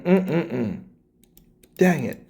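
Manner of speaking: ordinary talk, nothing marked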